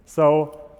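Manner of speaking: normal talking voice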